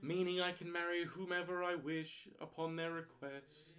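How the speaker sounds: a mock wedding officiant; solemn minister voice. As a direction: speak like a pompous celebrant. Officiant voice for ordination.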